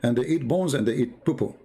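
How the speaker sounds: usual speaking voice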